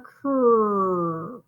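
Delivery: stretched "Cur" blend; slow blend sound "Cur". Shown as a demonstration. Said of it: "Did you guys hear that C R?".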